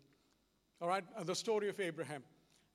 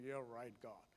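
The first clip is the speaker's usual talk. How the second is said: soft, lower, resigned You're right, God; eye-rolling resignation; 6 semitones lower, 9 dB quieter than usual. Retold or imitated. Abraham rolling his eyes at God, quietly resigned. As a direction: give in with a sigh of an eye roll. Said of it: "Abraham rolled his eyes and said".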